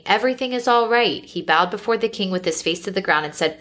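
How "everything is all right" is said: raised-pitch call of reassurance, not a shout; mild crying out. A narrator's reading. Messenger cries out to the king, mild higher-pitched call. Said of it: "cried out to the king".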